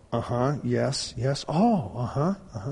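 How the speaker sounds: soft, halting phone responses, imitating his wife; lightly voiced wife taking in news by phone. Husband imitates wife hearing surgeon's news, softly stunned. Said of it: "I hear her go".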